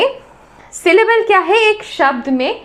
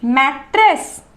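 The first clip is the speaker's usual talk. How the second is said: "Mattress" drawn out slowly as mat-trace; slow syllable demo; slower than usual. Shown as a demonstration. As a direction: stretch both syllables of "mattress" apart. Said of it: "बोलकर देखिए". ("Mattress" धीरे, दोनों हिस्से अलग करके)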